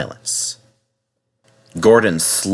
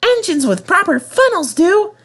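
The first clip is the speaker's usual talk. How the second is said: much higher, cheeky little engine voice; cheeky Percy. Acted. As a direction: tease the bigger engine. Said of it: "said Percy in his cheeky way".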